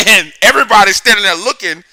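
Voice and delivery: loud, high-pitched telling through laughter; cracking up loudly while telling the story; laughing. Real, not acted.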